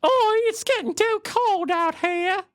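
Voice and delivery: high, slow mock British woman; put-on falsetto British lady; woman's voice. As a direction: squeal it in a drawn-out British falsetto. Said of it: "not gonna do the woman's voice".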